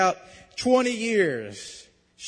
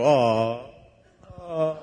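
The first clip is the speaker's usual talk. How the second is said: drawn-out aww like a touched audience; a whole congregation's aww, voiced solo; imitated crowd "aww"; 4 semitones lower, 5 dB louder than usual. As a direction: mimic a crowd cooing in sympathy. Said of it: "hear the whole crowd go".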